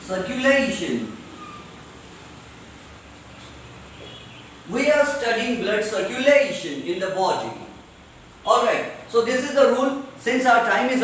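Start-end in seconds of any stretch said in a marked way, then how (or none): 0.1-7.5 CIRCULATION with a stretched "LAY"; stress before -tion. Shown as a demonstration. Teacher giving a last example, stretched "LAY". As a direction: lean on "la" in CIRCULATION. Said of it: "जोर से पढ़ना है".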